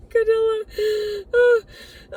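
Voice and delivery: choking up mid-sentence, high and cracking; crying. Real, not acted. Grieving her dog, sobbing through the words. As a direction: voice breaking into high sobbing cries. Breaking down over lost dog.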